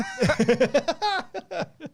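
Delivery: real laugh at the movie quote; high laugh. Real, not acted. Friends recalling a movie line, laughing high.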